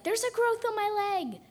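high, loud call of a silly game phrase; yelled-out call. Retold or imitated. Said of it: "someone would yell out".

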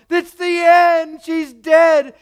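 high, loud, slowed cry of despair; mock despairing wail. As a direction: wail it like a grieving mourner. Voicing wailing mourners.

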